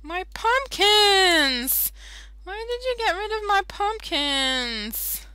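high, loud, slow wail of dismay; high, drawn-out cry of dismay over the missing pumpkins. Real, not acted. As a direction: cry out at the loss.

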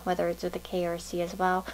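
usual speaking voice

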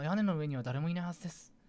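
ordinary talk, nothing marked

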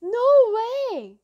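high, loud cry of disbelief. A narrator's reading. Boy cries out at what he sees, loud cry. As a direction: cry out in shock. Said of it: "one of the boys cried out".

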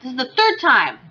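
loud, high, pleading with the pet; loud fretting at an angry pet; exasperated calling out. Real, not acted. Scolding pet dog in game.

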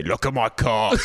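lightly put-on horse voice; talking horse. Answering what horses would say, as a horse. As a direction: blurt it as a cheeky horse. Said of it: "What would horses say?".

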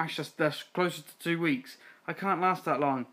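normal talking voice

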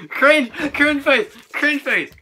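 high loud triumphant yell; shouting. Real, not acted. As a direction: shout it out gleefully. Calling out the loser.

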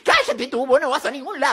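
ordinary delivery

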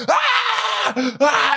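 high piercing mock scream; imitated dying yell. Preacher voicing the rescuer's dying yell for Jerry, with a piercing high mock scream. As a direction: shriek like a man hit by a train. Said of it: "you hear him yell".

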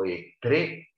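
ordinary delivery

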